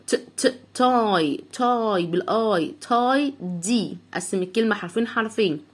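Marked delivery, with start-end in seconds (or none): 0.1-4.0 تاي دي broken into syllables, very slowly; slow spelling out. Shown as a demonstration. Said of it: "تعال نستهجى الكلمه دي". (تهجي بطيء جدا)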